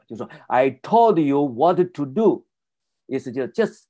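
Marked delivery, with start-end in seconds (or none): 0.5-2.5 "I told you what to do" skipping weak-strong in pairs; bouncing iambic rhythm. Shown as a demonstration. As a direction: beat out the iambs on "told", "what" and "do". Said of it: "就是这样的跳跃".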